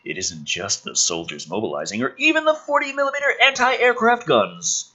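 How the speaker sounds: ordinary talk, nothing marked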